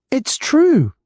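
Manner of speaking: a high, loud shout from a woman in the crowd. A narrator's reading. A shopkeeper in the crowd backing Hamish, a high loud shout. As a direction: call it out loud and high over everyone. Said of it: "shouted Missus Wellington from the crowd".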